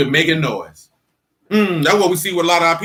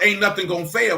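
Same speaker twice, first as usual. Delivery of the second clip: ordinary delivery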